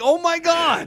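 high startled exclaim; shocked cry. Retold or imitated. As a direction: yelp it like you just got hit. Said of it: "I was like".

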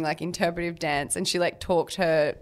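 ordinary talk, nothing marked

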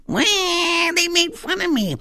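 high mock baby whine; a hammed-up baby crying about teasing; whining baby. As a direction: whine like a crybaby at work. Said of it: "whining like a baby".

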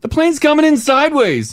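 high-pitched, comically panicked exclamation; retold alarm. Co-host reliving a sideways landing, mock-panicked outburst.

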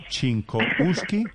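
Chinkowski drawn out slowly, rising as a question; slow, questioning try at "Chinkowski"; tentative pronunciation. Real, not acted. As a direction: sound out "Chinkowski" slowly, unsure. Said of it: "cómo se pronuncia su apellido".

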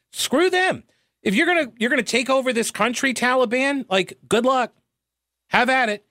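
high-pitched exasperated dismissal; scornful dismissal. Real, not acted. Venting scorn at Taliban.

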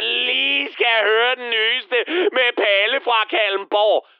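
slow, drawn-out mimicry of a pushy colleague; stretched, mocking party-guest request; mocking imitation. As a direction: drag the words out in a put-on voice. Imitates Michael pushing his request.

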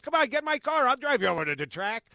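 high loud eager invitation; eager offer. Retold or imitated. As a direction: urge him into the car. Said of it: "and he said".

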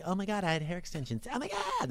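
high, breathy valley-girl mock; lightly mock a ditzy comic; airhead comic impression. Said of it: "the acts were like".

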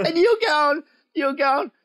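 ordinary talk, nothing marked

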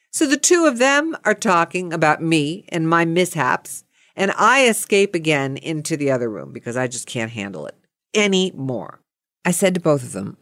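normal talking voice

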